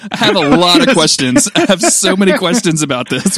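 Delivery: comically overwhelmed with questions; mock bewilderment. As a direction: demand an explanation, hamming it up.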